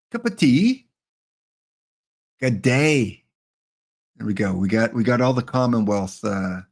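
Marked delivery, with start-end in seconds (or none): 0.1-0.9 much higher, hammed-up British accent; mock British accent. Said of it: "Multicultural accent". 2.4-3.2 mock Australian accent. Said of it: "Multicultural accent".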